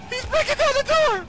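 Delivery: high slow panicked shriek; terrified scream. Real, not acted. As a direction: scream as the door gives way. Monster breaks door.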